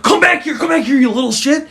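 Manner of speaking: hammed-up angry chase; mock chasing yell. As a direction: shout it after a running boy. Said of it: "just like".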